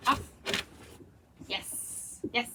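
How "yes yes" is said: breathy whispered yes, much softer. Real, not acted. Soothing the clingy dog with a whisper. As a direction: hush it to calm her.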